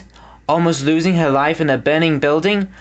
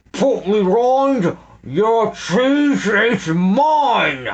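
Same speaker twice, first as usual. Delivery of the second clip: gloating villain; 6 semitones higher, much slower than usual. A narrator's reading. Snatcher gloating.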